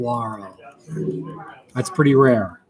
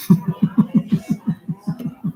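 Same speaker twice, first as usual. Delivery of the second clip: breathy laugh; 8 semitones higher than usual. Real, not acted. Laughs at own boring reading.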